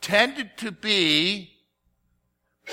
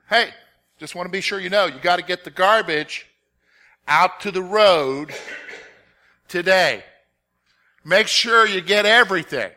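louder, pressing each instruction home; emphasizing; 5 dB louder than usual. Retold or imitated. Father insisting to his son about the garbage, loud and emphatic. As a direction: drive the point home to the boy. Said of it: "I'm emphasizing so that he gets the".

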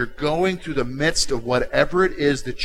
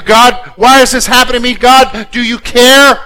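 loud, higher, demanding; angry questions at God; 7 semitones higher, 11 dB louder than usual. Retold or imitated. Angry believer shouting at God, louder and higher. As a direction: hurl the questions at God in anger. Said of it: "asking questions out of your anger".

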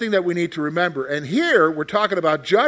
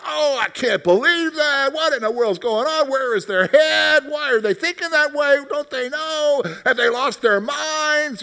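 worked-up outrage; 8 semitones higher than usual. Retold or imitated. Teacher acting out his own outrage at the news while driving, high exasperated rant. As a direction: play yourself ranting at the news, all worked up. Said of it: "it'll cause the reaction".

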